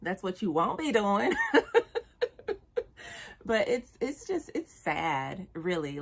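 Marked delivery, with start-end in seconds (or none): 1.4-3.4 real laugh, high and loud; high burst of laughter. Real, not acted. Laughing at the ex's tantrum, high loud laugh. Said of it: "I'm laughing".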